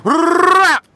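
high loud chant; shouted sign-off. Acted. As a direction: shout it out twice.

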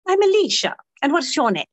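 bright British pub lady; high-pitched Cockney barmaid voice; British accent. Acted. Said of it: "I've been British all week".

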